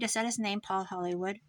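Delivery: usual speaking voice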